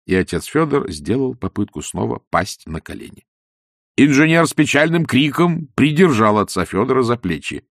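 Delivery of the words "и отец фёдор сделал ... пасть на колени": ordinary delivery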